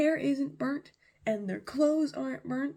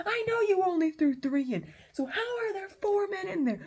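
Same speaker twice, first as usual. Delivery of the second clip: high, loud frustrated retort; acted exasperation; 10 semitones higher, 4 dB louder than usual. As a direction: snap back as a bewildered, impatient king. Exasperated king answers his men.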